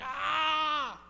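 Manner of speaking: half-mock cry of fed-up parent; frustrated shout. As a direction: let out a burst of mom frustration. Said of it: "and I'm like".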